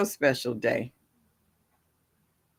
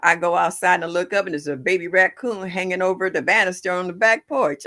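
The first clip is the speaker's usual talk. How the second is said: usual speaking voice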